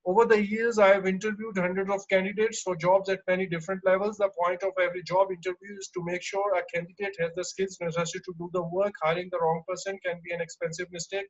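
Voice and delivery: fast, flat run-on reading with no pauses; robotic reading. Shown as a demonstration. Teacher demos robotic reading for student, fast and unpaused. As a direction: rattle it off like a robot, no chunking. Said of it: "This is a robotic way of saying the things".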